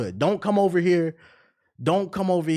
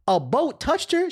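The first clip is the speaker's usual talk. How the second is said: usual speaking voice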